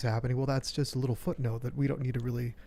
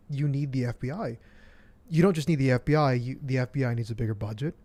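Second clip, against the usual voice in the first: ordinary talk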